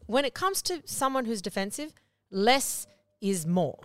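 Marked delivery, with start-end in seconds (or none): 2.3-3.8 less is more said slowly; drawn-out key advice; slow emphasis. Shown as a demonstration. Coach slowly stressing the main rule to viewers. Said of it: "slow emphasized advice".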